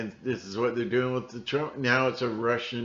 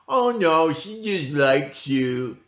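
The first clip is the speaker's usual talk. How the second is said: mock dismissive; 6 semitones higher, 8 dB louder, slower than usual. Mocking people who excuse her.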